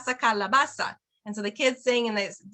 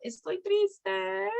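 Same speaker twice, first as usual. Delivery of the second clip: acted sad; 9 semitones higher, much slower than usual. Shown as a demonstration. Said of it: "the kids act it out".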